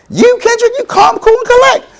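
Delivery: very high, astonished disbelief; incredulous coach. Retold or imitated. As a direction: sound like you can't believe it.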